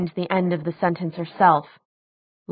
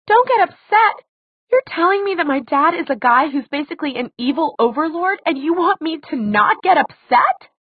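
high, loud, incredulous retort; outraged; 10 semitones higher, 4 dB louder than usual. A narrator's reading. Daughter turns on the man calming her, outraged. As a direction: throw his words back at him, pitched way up.

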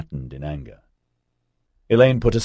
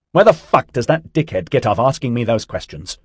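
loud, higher, furious outburst; angry yell; 5 semitones higher, 7 dB louder than usual. A narrator's reading. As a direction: yell it in outrage. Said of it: "she yelled".

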